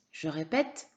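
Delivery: ordinary delivery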